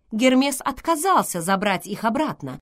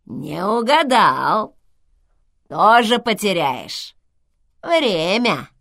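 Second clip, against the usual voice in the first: slow, loud creaky intercom voice; скрипучий голос; 2 semitones higher, 7 dB louder, slower than usual. A narrator's reading. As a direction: creak it out slowly and loudly. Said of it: "сказал голос старушки". (скрипучим голосом, громко и медленно)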